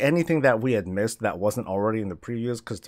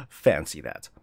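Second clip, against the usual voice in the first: chuckling dry irony; laughing ironic; 7 semitones lower, slower than usual. Real, not acted. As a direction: laugh it off wryly.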